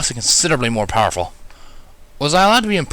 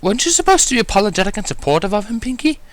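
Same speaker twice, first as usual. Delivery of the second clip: high, refined lady chiding lightly; gentle reminder; 7 semitones higher than usual. A narrator's reading. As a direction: remind her friend, elegantly. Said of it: "Rarity reminded gently".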